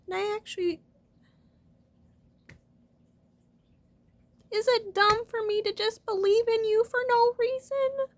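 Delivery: choked-up, crying while trying to believe. Real, not acted. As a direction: speak through real crying, voice straining high. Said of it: "I don't know why I'm always crying".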